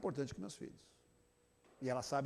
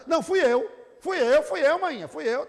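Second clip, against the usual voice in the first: put-on high, loud, eager child claiming the credit; 8 semitones higher, 10 dB louder than usual. Child eagerly claiming to his sister that he drew it. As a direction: jump in to grab the prize.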